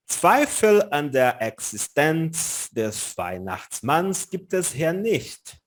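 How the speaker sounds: German sentence read slowly, word by word; slow reading aloud. Shown as a demonstration. Teacher reading a documentary line to students, slow. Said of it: "Olha só o que que ele tá dizendo aqui".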